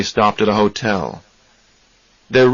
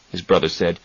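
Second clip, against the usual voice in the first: ordinary delivery, nothing marked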